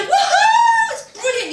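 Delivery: high, loud mock whoop of delight; thrilled kid shriek. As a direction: squeal it like a kid who just saw a flasher. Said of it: "and you'd be like".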